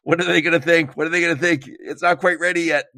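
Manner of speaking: high, fretting mock panic; pained worry. Host voicing a founder about to ship, anxiously. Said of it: "it's a little painful 'cause you're like".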